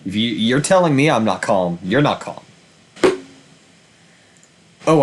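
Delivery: ordinary delivery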